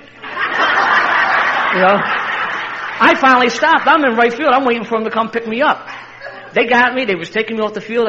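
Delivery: high and loud, thrilled; breathless delight; excited retelling. Real, not acted. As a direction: relive the moment with glee. Climax of the story, laughing.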